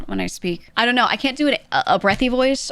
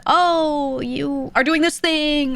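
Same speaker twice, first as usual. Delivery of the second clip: high, drawn-out "oh, you..."; exaggerated slow high realization; mock diagnosis; 8 semitones higher, much slower than usual. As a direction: stretch it out like a know-it-all spotting it. Imagined coach spotting a flaw.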